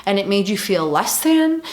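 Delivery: ordinary talk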